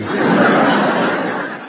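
husky laugh; breathy laugh. Real, not acted. Tallulah laughs at Judy's quip.

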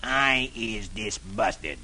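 low, slow disgusted growl. Acted. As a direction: grumble it deep and slow. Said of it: "Brer Buzzard growls".

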